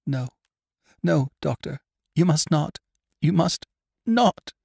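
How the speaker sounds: high, pleading anguish; anguished cry. A narrator's reading. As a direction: cry out in desperate protest, rising. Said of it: "With a cry of anguish".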